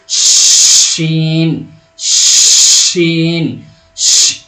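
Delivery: شین held long, loud rushing sh with teeth apart; airy drawn-out sheen. Shown as a demonstration. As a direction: let air stream out on the sh of شین. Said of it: "تو کیسے بولیں گے اس کو".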